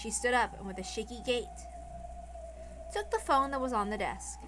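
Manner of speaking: usual speaking voice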